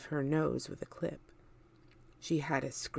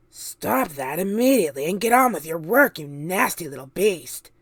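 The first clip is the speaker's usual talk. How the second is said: louder, harsh command; ordering; 9 dB louder than usual. A narrator's reading. Aunt Sponge ordering James back to work, louder. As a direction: bark the order at the boy. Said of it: "Aunt Sponge ordered".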